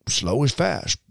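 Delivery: high Southern drawl; thick Southern accent. Retold or imitated. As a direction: say it as a Southern motto. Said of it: "it's this very thick Southern accent".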